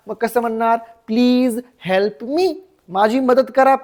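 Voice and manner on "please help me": very slow words, rising at the end; slow teaching model. Shown as a demonstration. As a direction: draw out each word for learners. Teacher models the English sentence. (शिकवण्यासाठी अगदी सावकाश)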